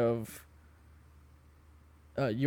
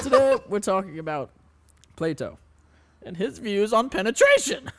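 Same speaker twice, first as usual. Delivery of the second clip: Professor Dicklecunt; 6 semitones higher, 4 dB louder than usual. Acted. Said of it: "I'm introducing myself".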